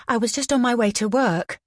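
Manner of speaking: put-on nervous young witness; anxious. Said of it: "the girl was saying anxiously".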